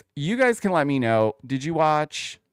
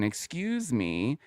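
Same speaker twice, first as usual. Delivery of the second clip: normal talking voice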